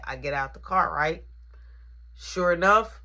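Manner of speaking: ordinary talk, nothing marked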